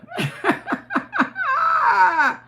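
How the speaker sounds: long laugh that starts high and falls away; delighted high laugh. Real, not acted. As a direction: let a high laugh ring out and fade. Laughs at daughter's remark.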